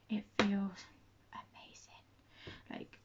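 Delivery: soft breathy whisper. Real, not acted. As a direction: whisper it like a secret. Gushing about the eyeliner.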